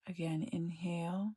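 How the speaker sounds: calm, even meditation voice with long pauses; guided meditation. Acted. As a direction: gently guide them inward, leaving space between phrases.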